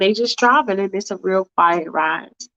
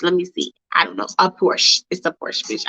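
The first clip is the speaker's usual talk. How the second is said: usual speaking voice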